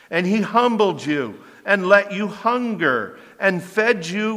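loud, high, proclaiming Moses' words; fervent scripture reading. Acted. Preacher reads Moses addressing Israel, loud fervent proclamation. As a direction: declaim the passage like a prophet. Reads Deuteronomy at full force.

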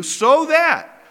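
"so that" punched high and loud; emphatic stress. Real, not acted. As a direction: lift and hit "so that" hard. Stresses key link in verse read.

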